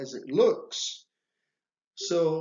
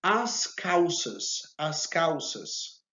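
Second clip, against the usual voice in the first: slow model repeat; slower than usual. Shown as a demonstration. Teacher drills the class with a slow model word. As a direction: say "As calças" slowly, stretching each syllable. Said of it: "slow repetition for learners".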